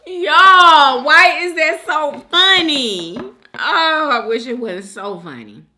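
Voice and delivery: loud and incredulous with amusement; amused exclamation. Real, not acted. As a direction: call out, overcome by how funny it is. Exclaims after laughing fit.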